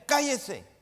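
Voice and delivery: a sharp, loud scolding, pitched high; rebuking. Retold or imitated. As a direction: hush the blind man, loud and high. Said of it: "muchos le reprendían".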